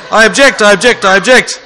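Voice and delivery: hammed-up objection, laughing; mock objecting. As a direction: tease like a whiny teen. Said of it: "They just object to everything".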